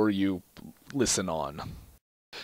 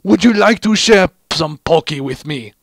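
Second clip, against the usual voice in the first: Heavy voice; 4 semitones higher, 8 dB louder than usual. A narrator's reading. Heavy voiced offering Pocky.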